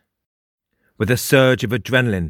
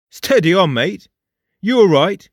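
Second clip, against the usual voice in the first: higher, louder conductor checking on a passenger; concerned train conductor; 8 semitones higher, 5 dB louder than usual. A narrator's reading. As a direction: call out, pitched up, to steady someone. Train conductor addresses Jake.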